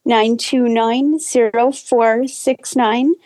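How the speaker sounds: slow, careful phone number; slowly dictating a phone number; slow enough to write down. Real, not acted. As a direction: read out the digits slowly, group by group. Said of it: "I'll try to say it slow enough".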